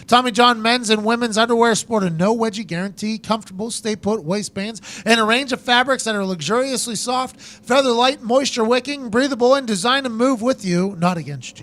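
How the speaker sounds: punchy, exaggerated sponsor pitch; announcer-style podcast promo; ad read. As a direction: sell it with deliberate emphasis. Host reads sponsor ad.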